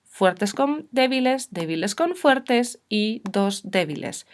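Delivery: usual speaking voice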